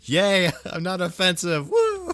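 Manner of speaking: high, loud, laughing celebration; gleeful cheer. Real, not acted. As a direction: whoop with laughter. Celebrates not being offensive.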